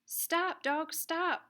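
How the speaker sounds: calling out. Acted. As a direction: call out stop to the dogs. Calling the dogs at red light.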